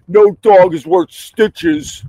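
high, slow, drawn-out mock voice of a careless dog owner; sneering parody of a careless owner; mocking the guy. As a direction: stretch it out like a snooty fool. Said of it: "That said everything when he goes".

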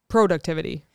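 "Productivity" with a long O, PROH-duc-tivity; alternate pronunciation. Shown as a demonstration. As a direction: open "Productivity" on "pro" as in "go". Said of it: "I say that word wrong".

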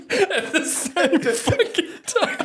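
cracking up. Real, not acted. Cracking up telling story.

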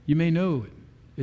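ordinary talk

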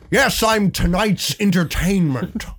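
high, slow, drawn-out villain voice; Heath Ledger's Joker. Acted. Greeting security guys at the door, Joker voice. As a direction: stretch it out like a theatrical movie villain. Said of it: "I did the Heath Ledger voice".

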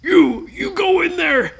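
high barked command, shouted; captain's order. Acted. Blackbeard shouting his order at a pirate. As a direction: point and order him in.

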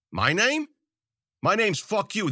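high, insolent comeback; defiant retort. A narrator's reading. Captive on his knees answering Zoot, defiant. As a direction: spit back defiance, pitched high.

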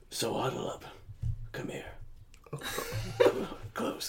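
hushed, breathy whisper; whispering on the phone. Acted. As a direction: whisper it close to the ear. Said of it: "I'm whispering directly into your ear".

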